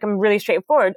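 ordinary talk

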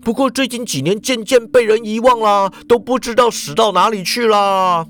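lightly voiced panicky Japanese man; Ikeda answering on the run. A narrator's reading. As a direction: answer while still running. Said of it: "脚步不停地回说".

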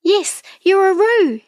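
high-pitched, excited bird voice; excited little kiwi. A narrator's reading. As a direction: exclaim it high, all excited. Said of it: "all excited".

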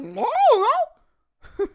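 high, pouty mock refusal; pouting no. Comic imitating the woman turning down marriage, high pouty no. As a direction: whine no with the lip out. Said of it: "You wanna get married?".

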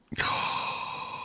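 long, soft breathy exhale; long sigh. Real, not acted. After telling of selling for a hundred grand, sighing. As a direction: let out a heavy breath. Sighs after the tiny sale price.

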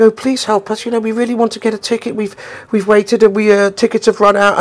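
high-pitched, beseeching voice; imitated pleading girl. Girl in the street begging the band for tickets, high pleading voice. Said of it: "And the girl is going".

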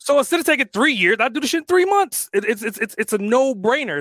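high, loud and fired up; excited. Real, not acted. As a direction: hype it up. Hyped about selling and restarting.

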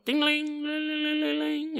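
high, soft sung ding-a-ling of a bell; bell imitation. Telling the Ellen Austin story, imitating the breakfast bell. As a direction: chime it like a tiny ship's bell.

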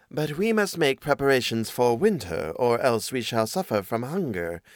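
measured storybook cat, at natural pitch. Acted. Cat warning the mouse about winter, in the cat's voice. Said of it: "oh, that's the cat".

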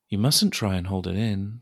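normal talking voice

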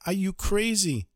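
panicked man scolding the rebbe; alarmed protest. Acted. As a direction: beg him to stop, terrified. Householder alarmed at opened shutters.

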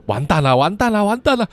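rising, frightened cry of doom; a terrified boy crying out that it's all over; panicked inner cry. Acted. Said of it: "心中不断地喊着". (惊恐地喊出)

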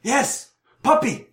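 high, put-on voice, urgent and pitiful; pleading injured man. Injured man begs help for his puppy, high pleading. Injured dog owner answering.

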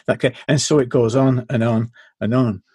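usual speaking voice

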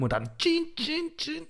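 high squeaky stab noises, fading; mock horror-movie slashing sounds; stabbing sound effect.

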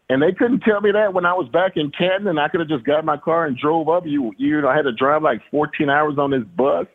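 half-laughing disbelief at the pointless trip; incredulous complaint. Retold or imitated. Player griping about the bus ride, mock indignation.

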